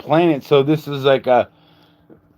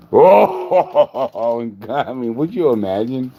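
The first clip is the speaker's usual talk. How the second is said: ordinary talk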